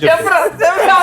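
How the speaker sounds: playful loud urging; egging on. Real, not acted. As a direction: shout at your friend to come out with it. Urging a friend to spill it.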